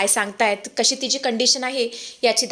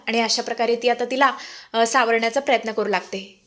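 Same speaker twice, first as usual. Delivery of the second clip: ordinary delivery, nothing marked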